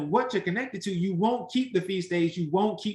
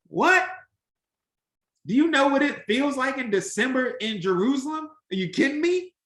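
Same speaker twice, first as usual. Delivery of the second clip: incredulous; 5 semitones higher than usual. Real, not acted. Preacher scoffing at December in Jerusalem, incredulous. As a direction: throw the question back in disbelief.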